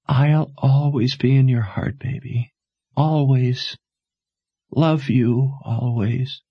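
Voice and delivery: lightly voiced dying mother, urgent and tender; a hint of a dying mother pleading; faintly voiced mother urging her to run.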